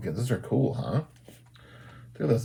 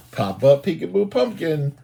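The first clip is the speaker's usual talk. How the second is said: ordinary delivery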